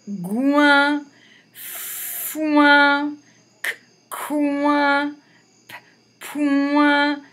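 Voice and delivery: high, loud, slow sounding out; loud, stretched syllables read to children; syllable reading drill. Shown as a demonstration. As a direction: decode each syllable for the class. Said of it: "on va essayer de lire les syllabes".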